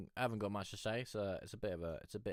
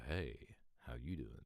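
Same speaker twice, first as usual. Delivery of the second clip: deep voice; 7 semitones lower, 10 dB quieter than usual. Retold or imitated. Telling a teen how he sounds now, low and deep. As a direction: lower and softer, dropped voice. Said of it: "you're now like the".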